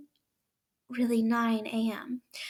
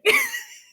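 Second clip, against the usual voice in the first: high burst of laughter; 10 dB louder than usual. Real, not acted. Amused by story.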